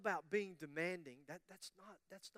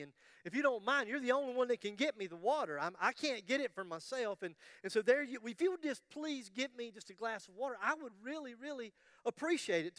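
higher, louder begging; wife begging husband for water, higher and louder; insistent pleading wife; 6 semitones higher, 4 dB louder than usual. Acted. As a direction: plead hard, running out of patience. Wife pleads again, more insistent.

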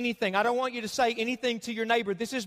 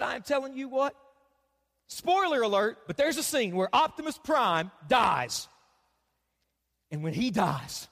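ordinary talk, nothing marked

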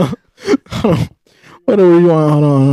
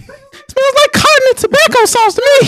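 high mock voice. Acting out AirPod bit.

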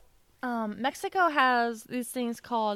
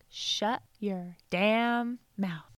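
very slow mimicry of a toddler's telling-off; slow, serious child; much slower than usual. Friend coaching a comeback to the fiance, slow and serious. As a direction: stretch out each word evenly. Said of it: "he slowed it down".

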